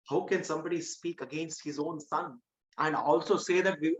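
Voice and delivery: usual speaking voice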